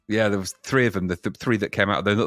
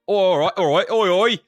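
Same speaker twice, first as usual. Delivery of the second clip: higher, louder Cockney geezer shout of greeting; 9 semitones higher, 3 dB louder than usual. Retold or imitated. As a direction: hail a mate like a market-stall Cockney. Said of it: "does a Cockney accent now and again".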